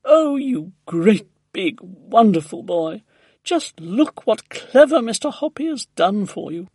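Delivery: loud, high praise for a pet; delighted gushing. A narrator's reading. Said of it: "she cried, stroking the tortoise".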